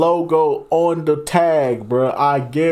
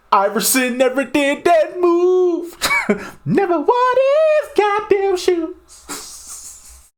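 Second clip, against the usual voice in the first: squealed, drawn-out disbelief; high-pitched protest; 13 semitones higher, slower than usual. Pretend. Streamer disputing the sneaker's history, in high mock outrage. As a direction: push it way up high and stretch the end.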